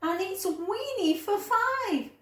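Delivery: a flustered woman at her wits' end; exasperated cry of an old lady. Acted. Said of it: "The little old lady cried".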